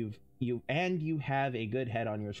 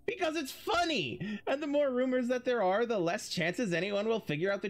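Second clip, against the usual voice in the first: high, bright burst of glee; higher excited giggly reply; gleeful; 8 semitones higher than usual. Acted. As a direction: squeal it like the joke is the best part.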